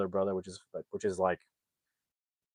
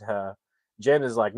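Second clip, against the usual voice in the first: usual speaking voice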